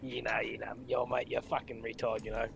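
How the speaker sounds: rough Aussie bloke voice; Australian accent. Pretend. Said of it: "Why did you go into an Australian accent".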